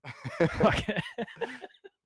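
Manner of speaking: high burst of laughter; real laugh at the bit; big laugh. Real, not acted. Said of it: "That was it".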